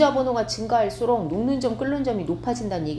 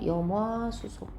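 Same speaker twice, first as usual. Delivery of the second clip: 염화 수소 slow and softer, 화 drawn out; reading demonstration; 5 dB quieter, slower than usual. Shown as a demonstration. Teacher answering how it is read, slow demonstration. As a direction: read 염화 수소 slowly and softly for learners. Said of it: "이렇게".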